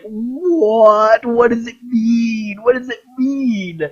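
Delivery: high, whiny, half-crying confusion; mock-wail the question at the screen; bewildered fans. Online fans after the ending, whining high in mock distress. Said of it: "the second game was like".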